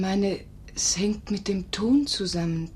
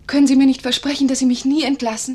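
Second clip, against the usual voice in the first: higher, louder, fast pleading; urgent plea; 6 semitones higher, 10 dB louder than usual. Acted. Nurse urgently begging master not to dismiss her. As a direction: beg him quickly and loudly.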